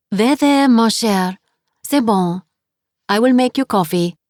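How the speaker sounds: put-on 2 heavy French accent, comforting a weeping guest; 3 hush her gently, thick French vowels; 4 French landlady soothing a crying guest. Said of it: "her accent was heavy".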